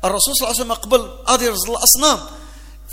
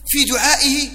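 usual speaking voice

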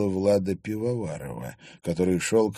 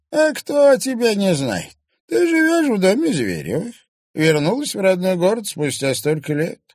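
high, louder crone with a spiteful edge; spiteful old crone; 13 semitones higher, 5 dB louder than usual. A narrator's reading. Old woman warns the girl, high and spiteful. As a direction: warn her off like a nasty old hag. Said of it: "злобно заявила старуха".

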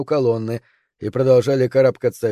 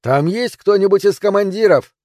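high, raised call to those above; yelling; 7 semitones higher, 4 dB louder than usual. A narrator's reading. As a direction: shout up for someone in charge. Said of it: "прежде чем снова начать орать". (громко кричит наверх)

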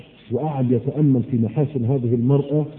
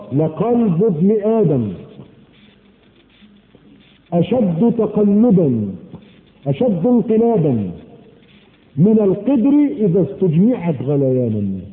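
slow, drawn-out solemn quote of the hadith; slow reverent hadith recitation; 3 semitones higher, slower than usual. Acted. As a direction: stretch each phrase out with weight.

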